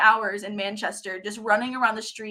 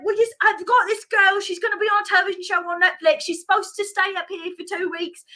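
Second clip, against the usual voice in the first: higher-pitched imitated British driver; British accent; 7 semitones higher than usual. Driver pleading with construction workers, high British accent. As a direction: explain urgently in a put-on British accent. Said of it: "I'm loving your British accent".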